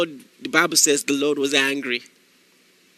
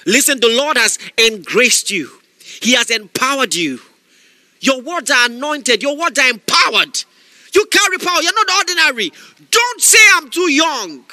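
higher, louder shouted preaching; fiery preaching; 7 semitones higher, 5 dB louder than usual. Acted. Preacher exhorting listeners not to make excuses, shouting. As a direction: preach it to the crowd, full force.

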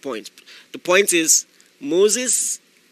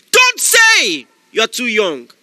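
louder shouted command; repeated shouted command; 4 semitones higher, 7 dB louder, slower than usual. Acted. Preacher repeating the command to the young listener, louder. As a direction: drive it home even harder. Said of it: "This is a word for someone".

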